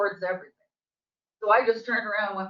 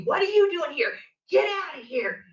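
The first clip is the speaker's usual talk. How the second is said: higher, mock yell at the intruder; retold yelling; 6 semitones higher than usual. Neighbor yelling at her to get out, mock yell. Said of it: "she starts yelling at me".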